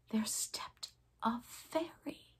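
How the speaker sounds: slow, soft hushed reveal; awed whisper. A narrator's reading. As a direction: breathe it out in wonder. Fairy appears.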